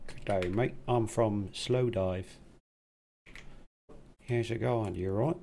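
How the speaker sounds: lightly put-on English accent; mock English accent. English band member greeting the crowd, mock English accent. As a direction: imitate an English bandsman's greeting. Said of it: "In his English voice".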